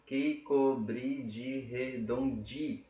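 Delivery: slow, drawn-out syllables; syllable-by-syllable counting. Shown as a demonstration. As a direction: separate every syllable for counting. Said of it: "tem sete sílabas poéticas".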